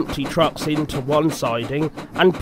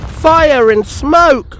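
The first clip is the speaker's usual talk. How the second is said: high, loud, slow cry of dismay; alarmed exclamation; 11 semitones higher, 8 dB louder, much slower than usual. A narrator's reading. Thomas feels the rails give way, high alarmed cry.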